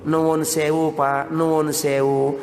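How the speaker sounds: low, drawn-out courteous apology; polite Javanese. Acted. Village in-law knocking on the door in the morning, very politely. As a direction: beg pardon politely, lowering and stretching the words. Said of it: "Jawa kalau ngomong luar biasa santun".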